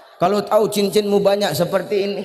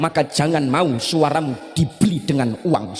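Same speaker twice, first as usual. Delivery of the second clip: usual speaking voice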